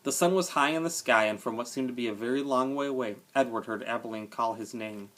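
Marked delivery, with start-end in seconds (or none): none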